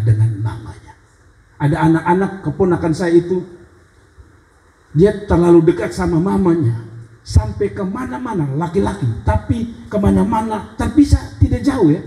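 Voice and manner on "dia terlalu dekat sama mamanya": ordinary delivery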